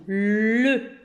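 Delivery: put-on overstress le so children hear it; exaggerated pronunciation. Shown as a demonstration. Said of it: "on exagère". (prononciation exagérée de le)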